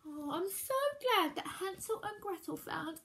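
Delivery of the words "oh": ordinary delivery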